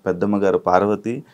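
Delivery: energetic anchor welcoming viewers; show host intro. Acted. Anchor greeting viewers at the show's start, upbeat host read.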